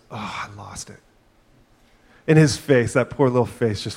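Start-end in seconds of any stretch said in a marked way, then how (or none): 0.1-1.0 much softer, lower, deflated; dejected. Retold or imitated. Son finding the certificate gone, soft and deflated. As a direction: let the words sink as it hits. Son realizes award lost.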